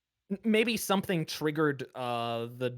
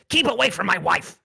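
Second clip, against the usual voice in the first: louder, higher angry squeak; protective rat shout; 4 semitones higher, 7 dB louder than usual. Acted. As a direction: snap at them to back off. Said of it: "And he says".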